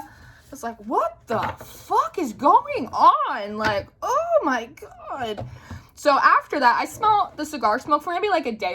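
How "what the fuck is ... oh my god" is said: high, hugely stretched-out words; retold exasperated disbelief. Narrator overwhelmed by dream coincidences, slow high disbelief. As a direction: drag every word out in mock wailing.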